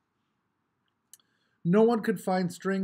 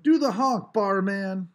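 loud, high shout from the crowd; a kid in the crowd yells at the runner, loud and high; 3 semitones higher, 6 dB louder than usual. A narrator's reading. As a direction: holler across the street. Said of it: "they might still be calling".

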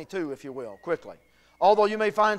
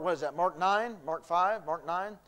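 ordinary delivery

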